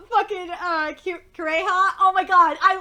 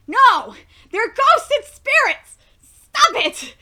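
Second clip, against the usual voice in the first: loud, higher protest; indignant outburst; 4 semitones higher, 7 dB louder, slower than usual. Real, not acted. Fan yelling at the anime's word changes, loud protest. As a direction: yell at the translators.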